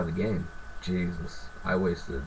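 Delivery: ordinary delivery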